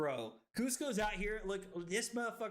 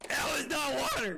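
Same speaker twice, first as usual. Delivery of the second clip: indignant shout; 5 semitones higher, 4 dB louder than usual. Real, not acted. Tricked by the drink.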